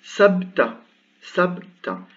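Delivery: Sabta with no vowel after b, clearly articulated; sukun demo. Shown as a demonstration. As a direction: stress the bare b in Sabta. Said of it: "On l'entend mieux si je fais comme ça". (Sabta, b sans voyelle bien marqué)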